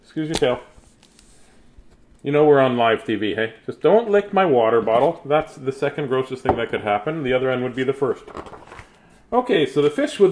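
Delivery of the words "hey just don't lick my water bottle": normal talking voice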